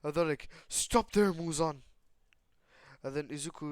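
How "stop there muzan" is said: raised, higher call to halt; commanding shout. Acted. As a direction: call out like a hero stopping a villain. Heroes confront the villain, calling out.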